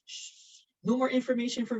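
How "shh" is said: a hushed, breathy shh to herself; playful whispered shush. Real, not acted. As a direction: hush it like a secret. Hushes herself about her address.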